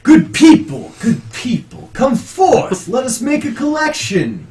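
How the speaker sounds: loud, high, rallying the faithful; priest calling out. Acted. Priest asking good people for a collection, calling out loudly. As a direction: call the crowd forward like a preacher.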